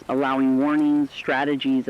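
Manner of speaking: ordinary delivery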